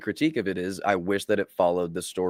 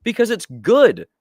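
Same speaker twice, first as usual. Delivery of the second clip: much higher, fired-up insistence; impassioned outburst; 11 semitones higher, 6 dB louder than usual. Real, not acted. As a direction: plead it like a fed-up fan. Heated rant about faithful adaptations.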